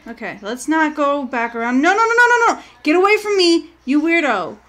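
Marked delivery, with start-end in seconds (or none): 1.8-4.6 real panicked no's, higher and louder; frightened yelling. Real, not acted. Streamer yelling at a player chasing her, scared. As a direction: shoo off the chaser in a panic. Chased again by a stranger.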